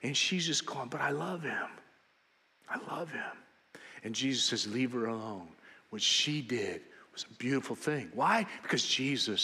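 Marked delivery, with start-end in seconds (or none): 0.9-3.2 softer, hushed "I love him" trailing away; tender, hushed. Acted. Mary quietly defending her devotion to Jesus, hushed and tender. 4.7-8.1 lower, softer, calm protective voice; gentle defence. Acted. Jesus defending Mary before the disciples, low and gentle.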